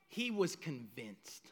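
low, soft, understated; quiet low statement. Acted. Quiet, low restatement of Saul's certainty.

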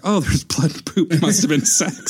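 lampooning the woman's conclusion in a silly voice; mocking. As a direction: parody her logic. Mocking her reasoning.

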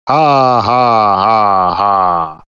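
descending mock evil ha ha; falling staged villain cackle; evil villain laugh.

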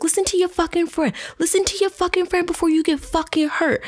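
high-pitched retold warning; heated mock yell, high and insistent; re-enacted threat. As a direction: act out going off, pitch held way up. Retelling her threat during street quarrel.

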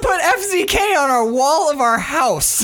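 high, laughing joyful squeal; overjoyed. Real, not acted. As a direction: burst with delight, laughing. Overjoyed about wall decal.

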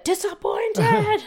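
high, stretched-out whine; hammed-up disappointment. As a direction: overplay being let down. Exaggerating her zoo disappointment.

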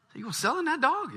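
high, eager Cajun neighbour; Thibodeau voice. Acted. Thibodeau questions Boudreaux about selling dog, high voiced. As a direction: pitch him up, curious.

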